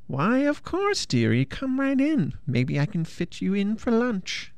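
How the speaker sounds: high, sugary witch voice; sweetly sinister witch. A narrator's reading. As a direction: lure him in like a hungry witch. Witch luring with menace.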